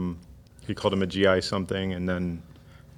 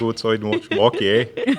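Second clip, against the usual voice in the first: hammed-up Canadian with a tagged-on eh; loud, jokey Canadian accent; 2 semitones higher, 5 dB louder than usual. Shown as a demonstration. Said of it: "go all Canadian".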